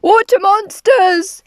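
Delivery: high, loud frightened shout; alarmed cry. A narrator's reading. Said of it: "one cried".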